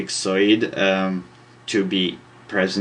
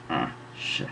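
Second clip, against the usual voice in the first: under-the-breath curse, much softer and lower; muttered curse; 7 semitones lower, 11 dB quieter than usual. Real, not acted. Teacher curses softly at his ugly drawing. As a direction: swear quietly to yourself at a mistake.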